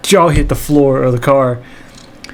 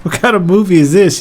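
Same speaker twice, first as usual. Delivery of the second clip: put-on amazed kid; 5 semitones higher than usual. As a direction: gasp it out, wide-eyed. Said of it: "I'm like".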